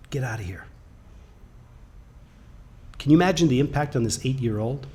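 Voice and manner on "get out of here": dismissive hush. Retold or imitated. Instructor throws the boy out.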